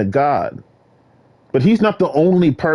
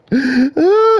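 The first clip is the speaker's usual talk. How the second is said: gasp; 12 semitones higher, 4 dB louder than usual. Acted. Imagining seeing it.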